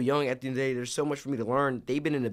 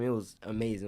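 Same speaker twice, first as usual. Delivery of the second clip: ordinary delivery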